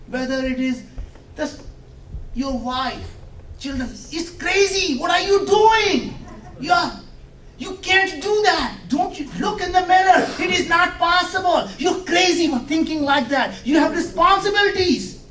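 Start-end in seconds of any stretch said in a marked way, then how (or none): 4.1-15.2 high, loud scolding of a dreamer, shouting by the end; scolding family voice. Acted. Wife and children scold the dreamer, high loud shouting. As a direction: lecture him like a fed-up wife.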